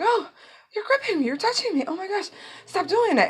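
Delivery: put-on very high, fussy girlish complaint; squeamish girl. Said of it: "then she's like".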